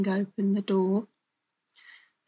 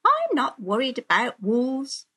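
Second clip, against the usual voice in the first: lightly voiced girl, pitched up; carefree storybook girl; unworried little girl; 4 semitones higher than usual. A narrator's reading. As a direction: brush off the warning in a higher voice.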